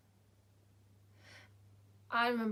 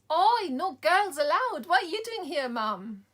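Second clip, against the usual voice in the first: put-on little boy's voice; 7 semitones higher, 12 dB louder than usual. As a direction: play the bossy little boy chasing mum off. Mother voices her son's teasing.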